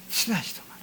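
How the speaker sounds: 친하지도 말어 whispered, breathless and soft. Shown as a demonstration. Repeated phrase, whispered take. (친하지도 말어를 속삭이듯)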